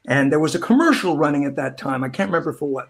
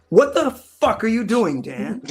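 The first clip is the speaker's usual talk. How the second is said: exasperated director; 8 semitones higher, 4 dB louder, slower than usual. Retold or imitated. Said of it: "finally goes".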